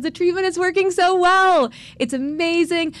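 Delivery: the doctor beaming as she shares the news; excited doctor. Retold or imitated. Doctor tells them the treatment works, bright and excited. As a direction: voice lifted high with thrilled good news.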